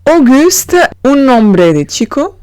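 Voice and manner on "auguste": Auguste said high and bright as /ogyst/; the name Auguste, modelled again; French Auguste. Shown as a demonstration. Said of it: "Entonces el conjunto es Auguste".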